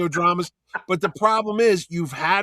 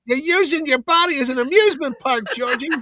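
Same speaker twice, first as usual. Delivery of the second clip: high, louder scolding mother; mother's voice; 8 semitones higher, 3 dB louder than usual. Retold or imitated. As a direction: scold him like his mother. Keeps up the mother imitation.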